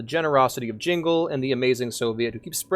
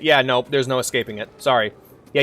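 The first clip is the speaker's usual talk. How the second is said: ordinary talk, nothing marked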